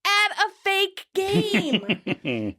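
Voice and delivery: high, drawn-out acted shout; exasperated shout. A narrator's reading. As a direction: yell it slowly, stretching every word. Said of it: "shouts again".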